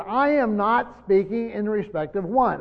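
emphatic, deliberate denial stressing "not"; strong negative. Acted. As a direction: lean firmly on "I am not", slowly. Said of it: "it's a strong negative".

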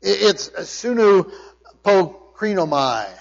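"sunupokrinomai" spoken slowly, syllable by syllable; Greek word. Shown as a demonstration. Preacher teaching his congregation the Greek word, slow and careful. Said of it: "It's one word in the Greek".

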